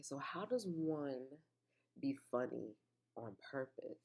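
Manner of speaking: slow, breathy whisper to herself; musing whisper. Real, not acted. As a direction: whisper it slowly, as if thinking aloud. Musing aloud about being funny.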